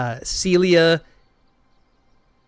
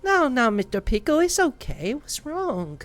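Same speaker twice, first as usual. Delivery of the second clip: gentle comforting voice; 10 semitones higher than usual. Acted. Henry comforts the crying Mister Pickle, gently. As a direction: soothe a crying friend lightly. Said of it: "Mister Pickle starts to cry".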